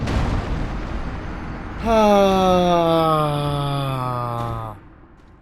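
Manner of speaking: loud heavy exhale that fades away; long frustrated sigh. Real, not acted. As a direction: sigh out the defeat. After dying in game.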